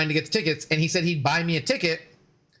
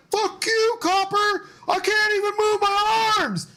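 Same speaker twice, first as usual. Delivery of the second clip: yelling at a cop; slower than usual. Retold or imitated. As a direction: scream it at the cop across the crowd. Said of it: "I'm yelling this at him".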